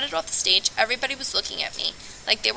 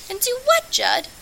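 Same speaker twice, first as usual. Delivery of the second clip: put-on much higher teasing girl's voice; lightly voiced Abby. Abby answers Moon's invitation, in a high girl's voice. Abby's reply, pitched up.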